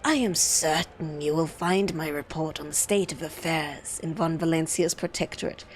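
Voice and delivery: low, ominous courtier; silky official, slowing to a near whisper at the end; sinister bureaucrat. Acted.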